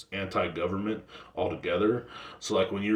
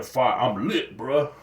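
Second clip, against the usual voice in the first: mock slang kid; 3 semitones higher, 4 dB louder, much slower than usual. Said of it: "what do they else say".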